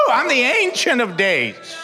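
building from high to shouting very high; preacher building to a peak on the names of God, shouting; preaching crescendo. Acted. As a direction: climb with each name until it peaks. Preaching climax.

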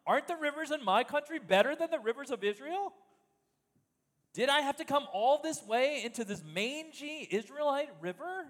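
proud Syrian commander ranting at the prophet, hammed-up indignation; ranting Naaman. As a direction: scoff at the Israelite river as a proud general. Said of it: "As Naaman continues his rant".

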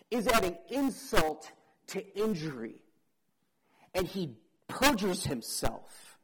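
ordinary talk

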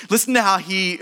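ordinary talk

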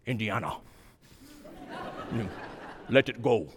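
low, soft Welsh lilt as Sean Connery's gentle father; a tender Welsh father letting go, low and hushed; Welsh accent. Retold or imitated. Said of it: "that beautiful Welsh accent".

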